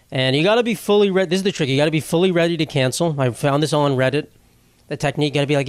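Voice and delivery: ordinary delivery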